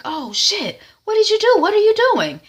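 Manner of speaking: panicked outburst of disbelief; shocked shout. Acted. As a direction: cry out in horror at what he sees. Moon shocked at Wednesday.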